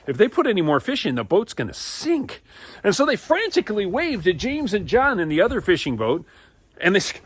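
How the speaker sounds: high, loud breathless narration of the catch; animated, building narration; excited storytelling. A narrator's reading. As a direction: tell it like it's happening right now.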